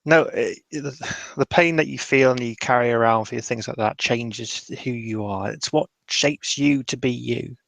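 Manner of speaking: ordinary talk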